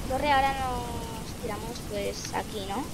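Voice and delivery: ordinary talk, nothing marked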